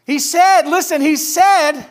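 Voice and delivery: high, loud, eager call for attention; excited announcing. Acted. Servants coming in to the waiting family, excited announcing. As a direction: burst in calling everyone to listen. Messengers insisting on the good news.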